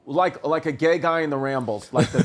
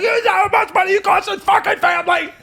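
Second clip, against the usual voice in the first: hammed-up screech, much higher and louder; mock screaming; 14 semitones higher, 4 dB louder, faster than usual. Dad mocking himself screaming at his toddler, hammed-up yell. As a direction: play a parent losing it, fast and shrill. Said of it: "I started screaming at my door".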